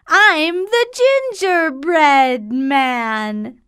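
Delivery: high boastful teasing voice; gingerbread man voice. Acted. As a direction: brag in a high voice.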